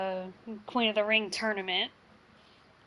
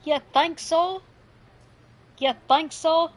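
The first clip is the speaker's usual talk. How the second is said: mocking; 6 semitones higher, 7 dB louder than usual. Mocking the rival's taunt.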